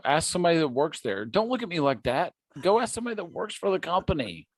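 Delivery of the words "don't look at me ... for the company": exasperated. Real, not acted. Heated argument over pronunciation.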